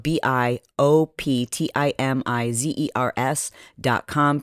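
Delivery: B I O P T I M I Z E R S dot com, letter by letter; spelling it out. Shown as a demonstration. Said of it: "again, is spelled".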